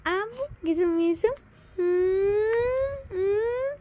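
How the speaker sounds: normal talking voice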